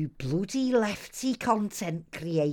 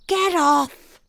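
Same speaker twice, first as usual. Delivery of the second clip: shouted protest; 6 semitones higher, 5 dB louder than usual. Acted. Unmasked impostor struggling.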